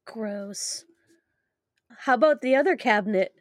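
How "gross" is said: breathy disgusted whisper. Real, not acted.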